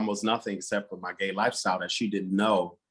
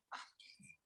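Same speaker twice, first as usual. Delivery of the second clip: barely voiced whisper, breaking open; whispered confession; 28 dB quieter than usual. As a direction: let it slip out as a fragile whisper. First time saying it, crying after.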